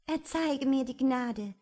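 pleading princess. A narrator's reading. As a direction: beg him like a fairy tale princess. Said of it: "sagte die Prinzessin".

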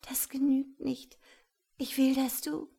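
high, urgent woman's voice; beg, then break off unfinished; pleading. A narrator's reading. Woman struggles to tell man her wish, pleading.

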